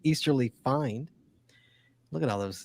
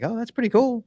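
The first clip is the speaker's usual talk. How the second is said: excited; 10 semitones higher, 3 dB louder than usual. Retold or imitated. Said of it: "I was like".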